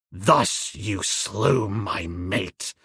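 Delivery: low, slow, menacing voice; slow, low, terrible threat; terrible hiss. A narrator's reading. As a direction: drag it out low and deadly. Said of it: "he hissed in a terrible voice".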